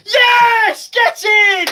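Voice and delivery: real goal celebration scream; goal celebration shout. Real, not acted. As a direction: roar it as the ball goes in.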